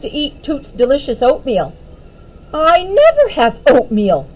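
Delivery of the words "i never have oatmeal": huffy. A narrator's reading. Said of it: "she huffed".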